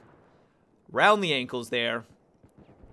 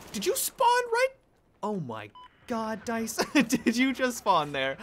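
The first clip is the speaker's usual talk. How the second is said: incredulous squeak; 7 semitones higher, slower than usual. Real, not acted. Disbelief at enemy spawn.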